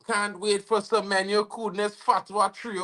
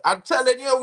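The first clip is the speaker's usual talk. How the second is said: ordinary delivery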